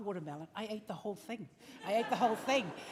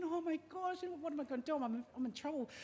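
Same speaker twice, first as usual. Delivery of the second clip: retold worry; 5 semitones higher, 5 dB quieter than usual. Hiding, fears being in trouble.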